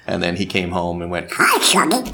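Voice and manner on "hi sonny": high, squawky quacking voice; Donald Duck. Retold or imitated. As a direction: quack the greeting like a cartoon duck. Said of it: "talk like Donald Duck".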